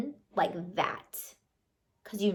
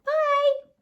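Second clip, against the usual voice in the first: gleeful mocking goodbye; 7 semitones higher than usual. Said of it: "And I was like".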